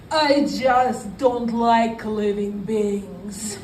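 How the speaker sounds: slow, dragged-out Russian accent. Shown as a demonstration. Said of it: "I say in my Russian accent".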